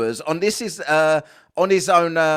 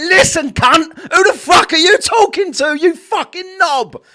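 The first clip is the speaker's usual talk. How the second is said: louder, higher, furious shouted telling-off; 8 semitones higher, 7 dB louder than usual. Pretend. A viewer finally telling Nathan Oakley off, in a furious shout. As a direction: scream him down like you've finally snapped. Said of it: "One of you people to say".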